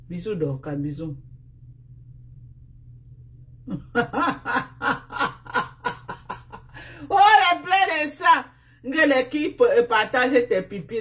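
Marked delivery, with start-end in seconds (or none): 3.7-7.1 long, high cackle at their tears; mocking laugh. Real, not acted. Streamer laughing at her opponents' tears, mocking cackle. 7.1-11.0 high, loud exclamation of mockery; loud jeering. Real, not acted. Streamer jeering at crying opponents, high and loud.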